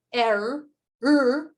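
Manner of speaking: R repeated with a trilled r; trilled R demo. Shown as a demonstration. Teacher repeating the trilled R sound. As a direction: roll the r in R firmly each time. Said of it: "por ejemplo la R".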